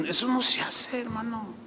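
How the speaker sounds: a pastor's quiet correction; soft rebuke. Retold or imitated. Chuy quietly correcting the husband, soft rebuke. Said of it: "Le dije".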